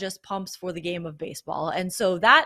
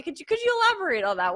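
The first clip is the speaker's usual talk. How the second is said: mock reporter question; 7 semitones higher, much faster than usual. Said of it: "were I a reporter out there".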